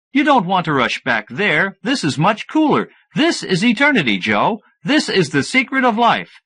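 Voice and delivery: a hint of an excited friend selling eternity; Harry.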